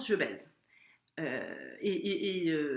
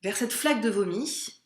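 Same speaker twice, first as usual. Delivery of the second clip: normal talking voice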